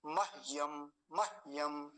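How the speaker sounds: मह्यम as mah-yam, h vowelless; half h. Shown as a demonstration. Teacher giving the actual form to students, half h. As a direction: break मह्यम after a short mah. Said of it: "अभी हकार आधा है तो कैसे बोलेंगे".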